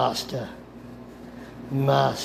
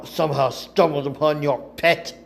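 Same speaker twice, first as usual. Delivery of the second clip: ordinary delivery, nothing marked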